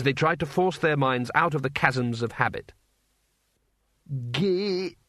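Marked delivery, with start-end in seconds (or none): 4.3-5.0 higher, broken-off first syllable; hesitant. A narrator's reading. Cohen attempts an unfamiliar word for his teacher, hesitant. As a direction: start the word and stall. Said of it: "said Cohen hesitantly".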